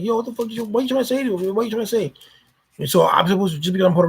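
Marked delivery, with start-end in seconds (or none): 0.0-2.1 higher-pitched mock indignation; retold indignation. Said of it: "And I'm like".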